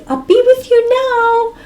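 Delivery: high, loud call to friends; imitated shout. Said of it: "she shouts to the friends".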